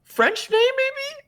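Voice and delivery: very high, questioning voice; unsure guess. Real, not acted. Host guessing at a name's origin, high and unsure. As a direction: squeak it upward as a doubtful guess. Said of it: "I don't know how to pronounce".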